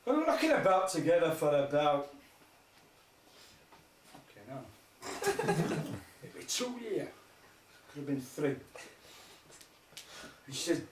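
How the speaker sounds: usual speaking voice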